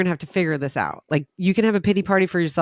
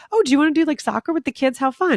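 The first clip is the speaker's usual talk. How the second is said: sing-song high coaxing voice, much higher; high chirpy mom; 8 semitones higher, faster than usual. Retold or imitated. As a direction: coax a little kid brightly, pitched up. Cheery mom voice in park.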